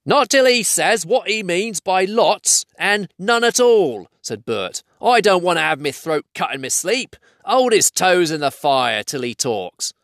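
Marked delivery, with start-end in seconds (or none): 0.1-4.1 slow, high, insistent troll; raised, argumentative Cockney-style troll; stubborn troll Bert. Acted. Voices troll Bert arguing. 5.0-9.9 raised, indignant Cockney-style troll; high, complaining troll voice; suspicious troll Bert. Acted.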